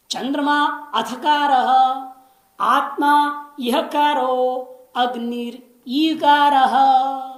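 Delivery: slow, loud chanted recitation of the verse; Sanskrit recitation. Shown as a demonstration. Teacher chanting the verse to students, slow and loud. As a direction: intone the mantra slowly and fully.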